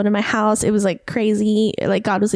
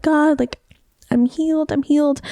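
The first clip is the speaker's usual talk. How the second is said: retold crying out; 5 semitones higher than usual. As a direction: imitate calling out to God, pitched up. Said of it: "crying out to God".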